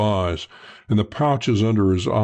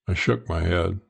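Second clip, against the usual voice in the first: ordinary delivery, nothing marked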